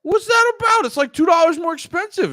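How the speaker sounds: loud, high comic outrage; incredulous shouting. Acted. Ranting about the pricey red hotdogs.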